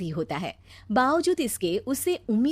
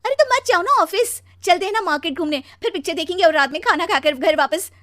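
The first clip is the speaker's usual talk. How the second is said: high, loud, excited planning; eager pushing; 9 semitones higher, 7 dB louder than usual. Acted. Wife urging husband to skip office for an outing, excited. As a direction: rattle off the outing brightly and loudly.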